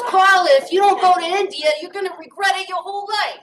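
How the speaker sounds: hammed-up New York Queens accent urging her on. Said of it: "I'll try to do his accent".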